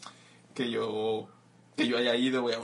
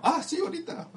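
much higher, laughing put-on dealer; mock dealer. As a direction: voice the dealer breezily. Dealer voiced answering the door. (vendedor en broma, voz mucho más aguda)

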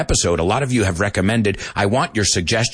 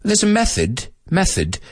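"method" tried in a British accent, starting higher; 9 semitones higher than usual. Shown as a demonstration. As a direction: say it as a British person would. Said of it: "say it as a British person".

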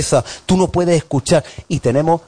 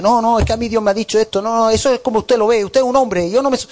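higher, fast protesting whine; higher mocking protest; mock stubborn believer; 7 semitones higher, faster than usual. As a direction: play the stubborn member brushing off the pastor. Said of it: "church member objecting".

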